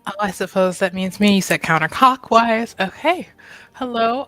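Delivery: ordinary talk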